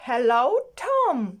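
slow, drawn-out character hello; friend's greeting. Acted. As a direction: greet a friend slowly, stretching the words. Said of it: "І перше, що сказав Тім". (протяжне дружнє привітання)

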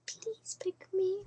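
high, slow, whispery plea; cute pleading act. Pretend. Entrant begging Shane to pick her in a cutesy whisper. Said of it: "I should stop the cute act".

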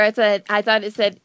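ordinary talk, nothing marked

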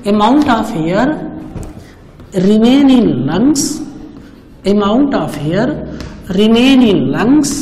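very slow, drawn-out phrases with long pauses; measured teacher read-out, piece by piece; word-by-word reading. Shown as a demonstration. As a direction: dictate the definition slowly, stretching each word. Reads textbook definition word by word.

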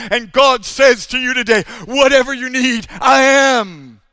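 loud, high, ringing sermon proclamation; full-force preaching. Acted. Preacher declaring God's names to the congregation, loud and soaring. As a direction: preach it out to the whole hall with power.

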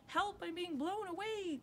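high, mock-alarmed child's cry; cry for help. A narrator's reading. Rosie caught by the wind, cry for help. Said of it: "cried Rosie".

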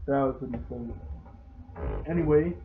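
ordinary talk

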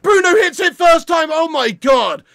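loud thrilled gushing; real elated fan; excited raving. Real, not acted. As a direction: rave about the goal, still buzzing. Still celebrating goal.

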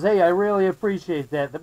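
ordinary talk, nothing marked

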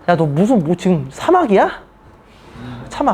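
ordinary talk, nothing marked

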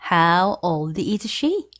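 each word drawn out slowly for learners; very slow model reading. Shown as a demonstration. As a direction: spell it out slowly so students can follow. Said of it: "이렇게 물으면 됩니다". (아주 천천히 또박또박 읽기)

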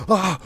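short, high, loud yelp of fright; startled yelp. Real, not acted. Player jumping at a loud noise in a horror game, startled yelp. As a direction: yelp as if something jumped out.

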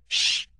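sharp, loud "shh"; hushing shush. A narrator's reading. Rabbit hushes his friends in hiding, sharp shush. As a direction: shush them firmly.

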